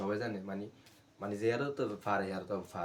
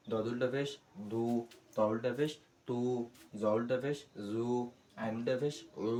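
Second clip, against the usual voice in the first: recitation drill. Shown as a demonstration. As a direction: chant each letter in a steady rhythm. Recites letter drill to students.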